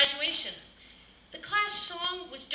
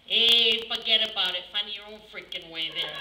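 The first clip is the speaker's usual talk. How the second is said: Italian GPS voice; 5 semitones lower than usual. Pretend. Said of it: "I'll do the Italian voice".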